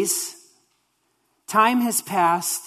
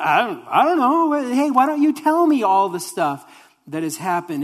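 high, playful feigned confusion; Jesus playing dumb to draw them out; mock-innocent questioning; 4 semitones higher, 4 dB louder than usual. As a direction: stumble over "don't" as if truly clueless. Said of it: "just a hilarious response".

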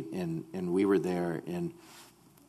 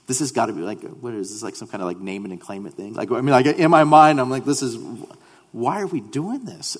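frustrated; 3 semitones higher, 7 dB louder than usual. Retold or imitated. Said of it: "I was so frustrated".

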